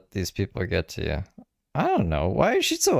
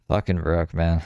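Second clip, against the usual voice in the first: ordinary talk, nothing marked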